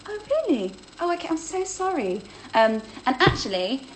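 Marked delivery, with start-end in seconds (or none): 0.1-2.2 high, sugary mock sorry; imitating a calm friend being sickly sweet; mock sweet apology. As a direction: play it over-nice to kill with kindness. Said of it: "She'd be like".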